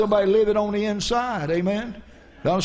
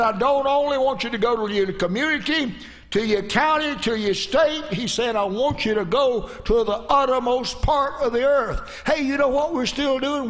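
raised, higher-pitched preacher's cadence; fervent preaching; 6 semitones higher than usual. Real, not acted. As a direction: drive each phrase home like a revival preacher.

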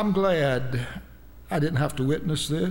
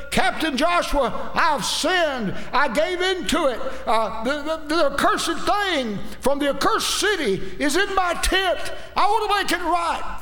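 Achan's anguished confession; 12 semitones higher, 4 dB louder than usual. Acted. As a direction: cry out a sinner's desperate confession. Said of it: "he could have said".

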